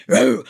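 sharp loud woof; a quick mock dog noise; mock dog bark. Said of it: "me being a bitch".

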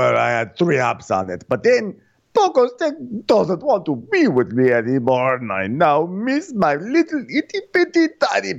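high-pitched, hammed-up wail over a lost love; put-on high voice, mourning melodramatically; exaggerated pining, drawn out at the end; mock-heartbroken lament. Voices Andrea falling off pogo stick.

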